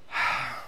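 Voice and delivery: acted heavy exhale; imitated reluctant sigh; weary sigh. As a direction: sigh before asking a favour. Friend hesitates before asking a favour.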